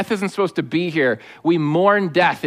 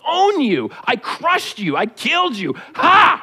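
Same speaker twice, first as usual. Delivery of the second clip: gloating taunt; 5 semitones higher, slower than usual. Acted. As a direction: gloat like a player over a tackled rival. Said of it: "you know what taunting is".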